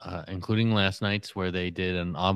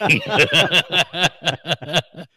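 big laugh, pitched up and loud; loud high laugh; 8 semitones higher, 7 dB louder than usual. Real, not acted. Laughing at his own impressions, loud high laugh.

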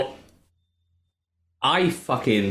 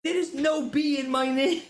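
laughing exasperation. Retold or imitated. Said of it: "And I was getting irate".

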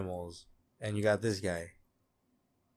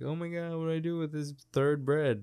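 clueless people; 6 semitones higher than usual. Acted. Podcaster mimicking people stumped by a third bread, mock dismay. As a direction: play a baffled eater, pitched up. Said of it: "People are like".

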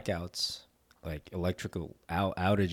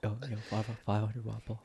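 soft-spoken coworker impression; mock quiet voice, barely projecting; Daryl; 8 dB quieter than usual. Making fun of a coworker who speaks too softly, mock quiet. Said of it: "sometimes he doesn't speak as loud".

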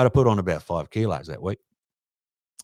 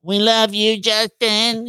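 teasing high falsetto fan imitation; drawn-out mock girlish squeal; Joe Pesci; 13 semitones higher, much slower than usual. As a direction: mimic adoring girls to put someone off. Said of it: "Pesci would wait until Justin would be getting in his backswing".

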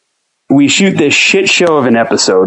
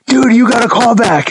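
urgent friend; 10 semitones higher than usual. Retold or imitated. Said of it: "And he's like".

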